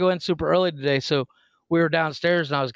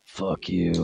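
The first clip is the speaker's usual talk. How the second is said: much lower deadpan curse; deadpan daughter; 10 semitones lower, 3 dB quieter than usual. Retold or imitated. Grumpy daughter on the stairs answering dad, low deadpan. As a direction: say it low and dismissive. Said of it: "she's like".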